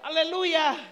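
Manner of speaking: ordinary talk, nothing marked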